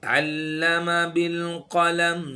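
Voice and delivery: slow, melodic tajweed chant; Quran recitation. Shown as a demonstration. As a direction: recite slowly, with each sound drawn out.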